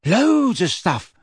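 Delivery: high, drawn-out boast; eager boyish pride; proud. A narrator's reading. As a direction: brag about it with delight. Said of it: "said Neville proudly".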